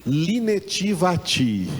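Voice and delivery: "Linetivati" said slowly, each syllable li-ne-ti-va-ti apart; "Linetivati" drawn out, ending on a fall; Hebrew pronunciation. Shown as a demonstration. Teacher models "Linetivati" slowly so students can repeat.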